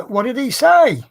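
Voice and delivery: high, loud eager asking; leaning in for the Pope's answer; eager question. Retold or imitated. Hairdresser presses her about the Pope, high and loud.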